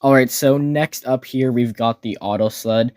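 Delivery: ordinary talk, nothing marked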